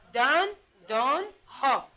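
دن، دان، ها in three slow, separated beats; firm teacher's syllable count on دن، دان، ها. Shown as a demonstration. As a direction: split it into دن، دان، ها with clear pauses.

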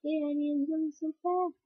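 high excited voicing; high whooping character voice. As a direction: cheer as the flying character. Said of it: "he's like".

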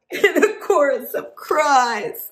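hammed-up mock wailing, high; fake crying. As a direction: ham up a chorus of sobs. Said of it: "those are fake cries".

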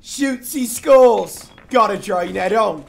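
loud, slow, hammed-up Aussie twang; bad Australian accent. Mocking his teammate, he drags out a bad Australian accent. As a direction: drag it out in a terrible Aussie voice. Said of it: "That's the worst Australian accent".

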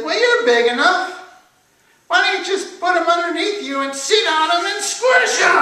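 very high, loud servant voice; high-pitched shouted suggestion; excitable servant. A narrator's reading. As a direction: shout the bright idea up high. Last servant pitches squashing the rooster.

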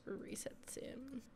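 ordinary talk

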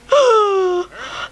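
high wheezing cackle, real; genuine laugh. Real, not acted. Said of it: "sounds like a fake laugh, but it's not".

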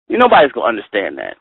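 ordinary talk, nothing marked

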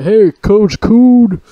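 high, slow, drawn-out taunting shout; loud high jeer from a driving car; mock yelled insult. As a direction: holler it from a passing car. Racists yelling from car.

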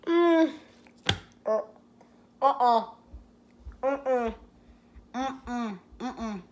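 swallowing while humming; grimacing after tasting; yogurt in mouth. Real, not acted. Seen 0: Sucks Go-Gurt just before, holds tube, mouth working while humming.

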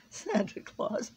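high, slow words broken by laughing; mocking laughter. A narrator's reading. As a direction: laugh helplessly at the absurd name. Bug laughs at the claim.

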